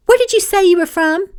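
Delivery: high, pointed interrogation; probing, accusing tone; suspicious questioning. A narrator's reading. Suspicious Brooke interrogates Gracie.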